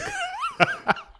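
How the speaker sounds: very high squealing laugh. Real, not acted. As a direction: burst into a shrill laugh. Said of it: "high laugh".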